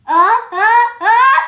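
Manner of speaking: loud rising pre-sneeze gasps; sneeze build-up. Acted.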